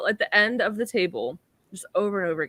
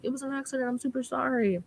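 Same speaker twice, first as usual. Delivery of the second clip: a softer, higher mock apology; apologizing; 6 semitones higher, 5 dB quieter, faster than usual. Ergo apologizing to Sam after the spill, soft. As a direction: say sorry sheepishly. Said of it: "he's like".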